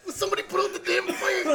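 high-pitched wrestler cry, half laughing; Macho Man. Acted. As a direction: yell it as a hammed-up wrestler through laughter. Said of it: "If he was talking to Macho Man".

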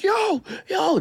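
high-pitched mimicked crowd call; excited onlooker. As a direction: imitate a startled fan calling out, voice leaping up. Crowd spotting [name] on the street.

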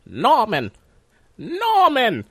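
a mock mother calling for her son, hammed up; Norman Bates' mother. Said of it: "I could do a mean Norman Bates' mother".

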